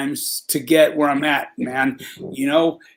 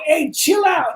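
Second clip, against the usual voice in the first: higher, half-shouted "chill out" in the put-on voice; mock shout; 11 semitones higher, slower than usual. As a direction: bark the warning up high. Guard told to calm down.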